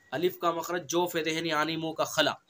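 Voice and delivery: usual speaking voice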